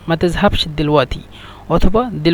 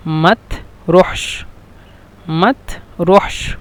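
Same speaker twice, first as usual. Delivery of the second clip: মাত রহস stretched out very slowly; slow demo; much slower than usual. Shown as a demonstration. Teacher drilling the Egyptian phrase slowly for the class. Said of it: "অথবা বলে".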